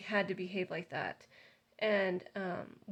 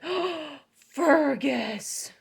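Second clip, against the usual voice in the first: higher, louder, frustrated cry of the dog's name; dismayed; 7 semitones higher, 8 dB louder, much slower than usual. Retold or imitated. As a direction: call the dog out in dismay. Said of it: "I said it exactly like I did on this tape".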